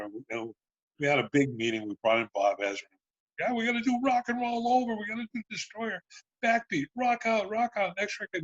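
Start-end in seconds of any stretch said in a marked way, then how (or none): 3.4-8.4 high-pitched, mock-enthusiastic band chatter; imitating excited bandmates; hyped-up band. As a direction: pitch it way up like a gung-ho band listing big plans. Band hyping plans in meeting.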